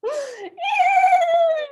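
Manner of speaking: high shouted neigh; whinny like a horse. Shown as a demonstration. Teacher neighing like a horse for kids. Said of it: "they neigh, right? Like".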